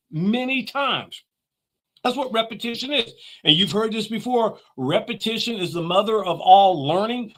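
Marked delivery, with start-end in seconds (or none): none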